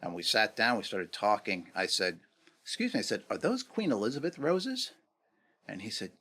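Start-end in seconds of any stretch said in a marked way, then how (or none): 2.6-4.9 polite inquiry. Retold or imitated. Friend's polite question, acted out.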